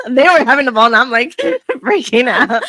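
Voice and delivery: laughing through the words, loud and bright; cracking up while telling it. Real, not acted. Coach laughing about her own panic at practice.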